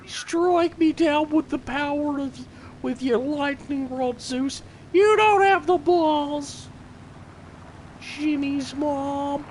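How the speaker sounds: high, slow, drawn-out taunt at the heavens; hammed-up, high-pitched grandiose dare; mock-epic defiance. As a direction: challenge a god, stretching words high.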